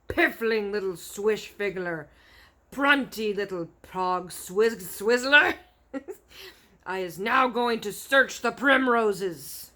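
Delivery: slow, loud mocking roar; shouting giant. A narrator's reading. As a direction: jeer and bellow. Giant keeps insulting.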